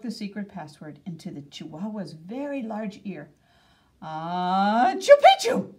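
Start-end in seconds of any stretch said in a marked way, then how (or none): 4.0-5.7 sneezed Chupichu, sudden and loud; sneeze-word. A narrator's reading. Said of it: "half sneezed".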